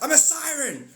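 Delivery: very high, loud siren announcement; mock grand siren reveal. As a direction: proudly declare it, up high. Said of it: "and you're like".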